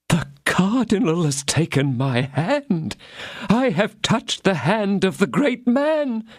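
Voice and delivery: high, slow, awestruck little mercer voice; excited mercer. A narrator's reading. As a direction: gasp it out like a dazzled shopkeeper. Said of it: "exclaimed Bonacieux".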